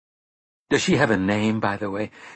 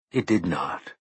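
plain reading voice

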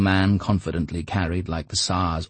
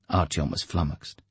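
normal talking voice

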